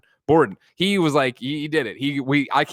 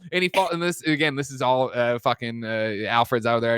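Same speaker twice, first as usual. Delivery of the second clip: ordinary delivery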